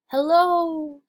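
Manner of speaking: loud call up to a giantess; shouting up. Acted. Jack calls up to the huge giantess, loud and high. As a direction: shout it up high to someone far above. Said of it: "Jack shouted up".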